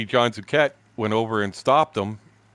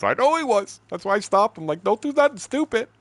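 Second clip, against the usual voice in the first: mimicking John; 12 semitones higher than usual. Buddy explains why he stopped the fight, high mock voice. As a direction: parody the friend's words, pitched way up.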